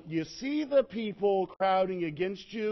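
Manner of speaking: ordinary talk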